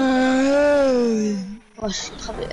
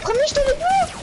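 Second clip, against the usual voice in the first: pleading protest; 12 semitones higher than usual. Real, not acted. Protesting he was on team.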